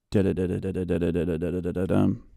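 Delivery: rapid-fire, even stream of da-da syllables; rhythmic syllable count. Shown as a demonstration. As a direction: tap out a steady speaking rhythm on nonsense syllables. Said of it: "we have a rhythm".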